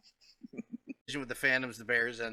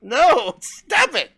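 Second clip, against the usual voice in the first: mock protest; 4 semitones higher, 6 dB louder, much slower than usual. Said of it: "I was like".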